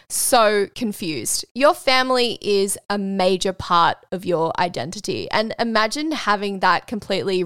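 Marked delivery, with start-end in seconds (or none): none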